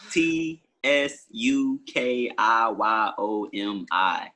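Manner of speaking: slow, letter by letter; spelled out. Real, not acted. Guest spells his name for listeners, slowly spelled out. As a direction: spell it out with long gaps between letters.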